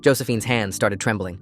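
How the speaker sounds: usual speaking voice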